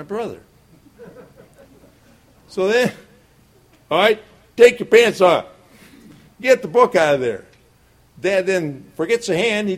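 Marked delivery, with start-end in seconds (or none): 2.5-7.4 stern father order. Retold or imitated. Father ordering son, belt punishment.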